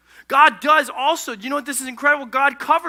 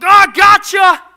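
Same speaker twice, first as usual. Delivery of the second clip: high, drawn-out pouncing yell; mock gotcha shout; 10 semitones higher, 13 dB louder, slower than usual. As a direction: shout it like an ambush, hammed up. Mimics a God who bull rushes.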